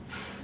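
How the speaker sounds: "th" as a soft, voiceless hiss of air; isolated TH sound. Shown as a demonstration. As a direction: blow air softly through "th", no voice. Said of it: "how to pronounce the sound T H".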